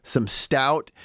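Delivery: ordinary talk, nothing marked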